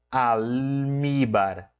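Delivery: "almíbar" very slow, the al held long before míbar; audible first syllable. Shown as a demonstration. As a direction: stretch the al of "almíbar" so it clearly shows. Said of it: "o al, ele vai aparecer".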